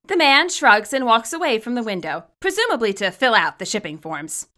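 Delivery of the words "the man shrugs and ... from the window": usual speaking voice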